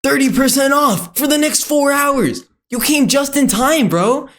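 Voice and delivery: high-pitched sales banner shout-out; mock website hype. Website pushing a fake countdown deal, mock hype.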